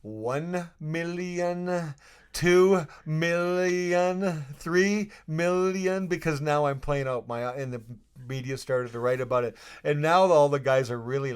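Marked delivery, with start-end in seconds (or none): 0.0-6.1 drawn out, very slow millions; slow counting. Retold or imitated. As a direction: count each number out slowly. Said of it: "I'll tell you how high I can count".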